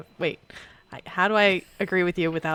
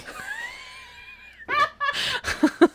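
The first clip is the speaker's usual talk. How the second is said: shrieking laugh. Real, not acted. Laughs at her own yelling.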